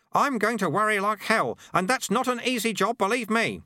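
put-on high-pitched, emphatic old-hand character voice; worried, emphatic sweeper. Sweeper telling Susan his job is worrying, high and emphatic.

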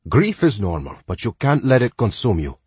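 put-on gentle advice in a Spanish accent. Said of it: "with a heavy Spanish accent".

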